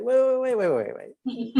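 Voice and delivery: high, rapid-fire repeated halt; acted-out sudden stop; urgent self-interruption. Catching herself mid self-blame, acted out.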